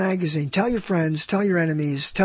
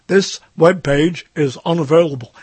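loud put-on computer announcement voice; error message voice; 5 dB louder than usual. Browser voicing its error screen, loud announcer voice. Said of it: "that's the voice I hear".

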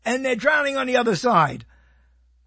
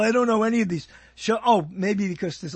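usual speaking voice

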